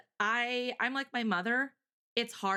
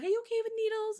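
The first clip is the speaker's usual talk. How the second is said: imitating nurse; 13 semitones higher, faster than usual. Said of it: "she's like".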